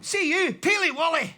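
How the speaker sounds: loud Scottish shout; broad Scottish accent. Acted. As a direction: call out in broad Scots. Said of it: "only me that ever learned to speak Scottish".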